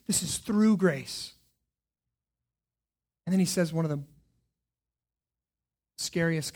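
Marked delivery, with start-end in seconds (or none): 0.1-1.3 "through" drawn out slowly; slow, lean into "through"; stress on through. Shown as a demonstration. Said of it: "This is by grace".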